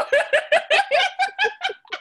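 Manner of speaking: shrieking laugh; host cracking up at guest; loud high laugh. Real, not acted. Burst of laughter.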